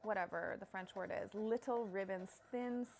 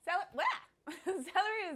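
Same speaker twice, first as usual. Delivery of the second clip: playful high voice; 10 semitones higher, 6 dB louder, slower than usual. Pretend. Playful voice while chopping.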